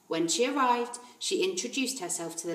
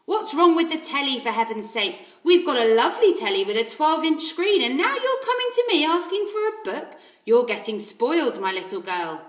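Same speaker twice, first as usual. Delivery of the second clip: loud, higher blustering rant; blustering father; 6 semitones higher, 9 dB louder than usual. Acted. The father scolding Matilda for wanting a book, loud bluster. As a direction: rant at her like a scornful dad.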